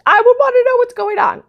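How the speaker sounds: very high-pitched, loud squeal of curiosity; mock-alarmed mom. Herself as a mom told by her kid, mock alarm. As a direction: play-act a mom shrilly demanding answers.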